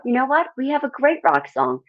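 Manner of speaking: high, bright-edged confident reply; mock sassy answer to the heckler; sassy comeback. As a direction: clap back with sass. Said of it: "I said".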